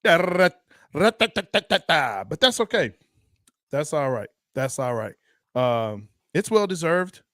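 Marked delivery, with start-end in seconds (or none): 0.0-2.2 rattling machine-gun burst; spray rat-a-tat shots with the mouth; high, punchy mock machine gun; imitated gunfire. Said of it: "she came in".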